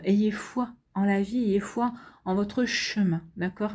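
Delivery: ordinary delivery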